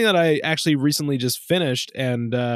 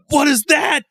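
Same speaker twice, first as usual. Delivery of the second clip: loud, high-pitched re-enacted disbelief; astonished outburst; 14 semitones higher, 7 dB louder than usual. Kid seeing a new console in the store, astonished. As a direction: act out being dumbfounded, voice leaping up.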